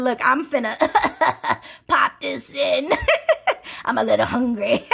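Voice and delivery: a light laughing take on Beetlejuice; half-laughing put-on ghoul voice, not quite landing. Vlogger voicing Beetlejuice about to eat a roach, laughing. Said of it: "my Beetlejuice accent isn't the best".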